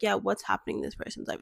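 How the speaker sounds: whispered, mock-curious gossip; mock nosy whisper. Mocking her own imagined curiosity about an ex-friend, whispered. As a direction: breathe it like a nosy busybody. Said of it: "I don't need to sit here and be like".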